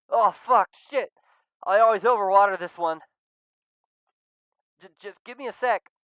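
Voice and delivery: high, loud startled cry; startled yelp. Acted. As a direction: jump in shock and swear. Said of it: "lets out a yelp of surprise".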